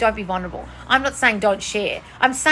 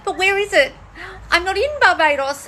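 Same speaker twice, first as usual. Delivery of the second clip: high, whining mock cry; mocking whiny Neville; 10 semitones higher than usual. Mocking the viewer as a whiny Neville. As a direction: whimper it like a spoiled child. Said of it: "you're just like Neville saying".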